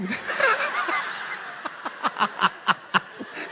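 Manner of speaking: breathless high laugh. Real, not acted. Laughs at his own story.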